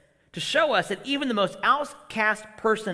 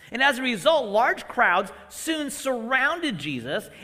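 normal talking voice

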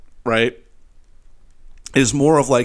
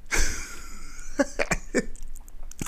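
breathy laugh; 9 dB quieter than usual. Real, not acted. Laughing after his impression.

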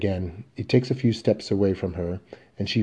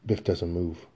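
ordinary talk

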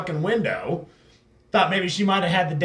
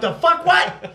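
high, loud outraged disbelief; mock shock; 12 semitones higher, 6 dB louder, slower than usual. Retelling his friend's reaction to the price, high and loud. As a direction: squawk it in shock. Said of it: "And you're like".

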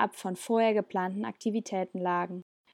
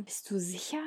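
breathy whispered question; softly whispered doubt; 7 dB quieter than usual. Acted. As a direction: whisper it close and unsure. Jonas asks, doubtful.

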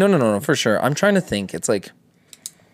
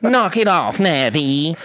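higher, shaky scolding voice; Cat Williams impression; 7 semitones higher, much slower than usual. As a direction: tell off the fairy, shaky and high. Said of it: "How does Cat Williams talk?".

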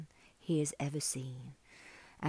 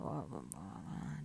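low, quiet wordless grumbling; imitating a man too despondent to talk; grumpy mumbling prince; 11 semitones lower, 9 dB quieter than usual. A narrator's reading. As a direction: mumble glumly, far down in pitch. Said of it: "doesn't really speak much".

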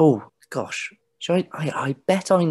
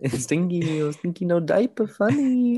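put-on higher and higher sing-song baby voice; mock baby talk; 8 semitones higher, slower than usual. Teasing the fart joke in a mock baby voice. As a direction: coo it like teasing a toddler.

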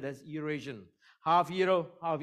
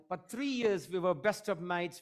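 usual speaking voice

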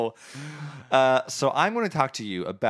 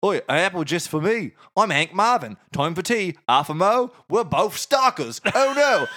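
high, hammed-up Cockney; Cockney accent; 5 semitones higher, 4 dB louder than usual. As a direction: play Adam as a broad comic Cockney. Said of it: "Cockney, Cockney".

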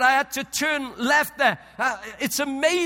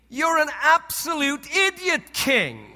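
higher, louder mock scolding; mock angry GPS; 5 semitones higher, 4 dB louder than usual. Preacher imagining the GPS insulting him, mock angry rant. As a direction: berate the driver like a fed-up sat-nav. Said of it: "she didn't say to me".

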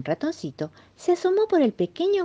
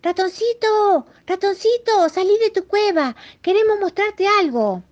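very high, loud calls; calling out; 5 dB louder than usual. Acted. Rabbit and mole summon the mouse from his burrow, calling out. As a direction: call from afar in a high sing-out voice. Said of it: "al conejo y al topo que lo llamaba".

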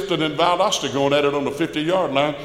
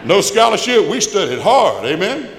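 preacher's shout; 8 semitones higher, 7 dB louder than usual. Real, not acted. Preacher rousing the congregation.

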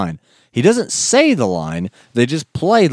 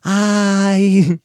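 much higher, startled voice; startled son; 9 semitones higher, 3 dB louder, much slower than usual. Retold or imitated. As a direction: squeak it caught out by Papa. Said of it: "he's like".

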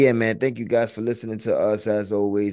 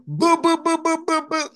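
much higher boom ad-libs, laughing through; [name] ad-lib; 4 dB louder than usual. Pretend. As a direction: redo the booms, pitched way up. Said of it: "That was a terrible boom".

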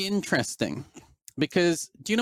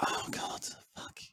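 breathy exasperated whisper; 14 dB quieter than usual. Real, not acted. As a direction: whisper it under your breath. Frustrated aside while ranting.

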